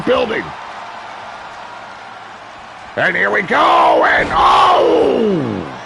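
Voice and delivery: hyped wrestling announcer riding every big move; live play-by-play. Acted. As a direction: call the match live with big excited shouts. Live wrestling match commentary.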